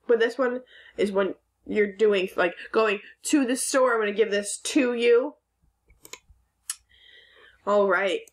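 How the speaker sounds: ordinary delivery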